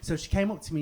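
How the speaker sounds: usual speaking voice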